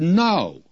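NO pitched high and set apart; a single emphatic NO in answer. Retold or imitated. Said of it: "He gives an emphatic no".

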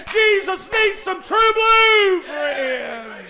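high, driving sermon delivery; full-force preaching. Real, not acted. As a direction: build to a shouted climax, drawing out the final words.

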